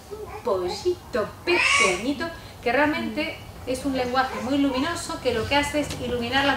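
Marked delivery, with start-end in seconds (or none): 0.1-2.3 higher, slow, bright and forward; forward luminous voice. Shown as a demonstration. Teacher models the right luminous forward voice for children. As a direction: place it at the front of the mouth. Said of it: "pueden notar si yo hago".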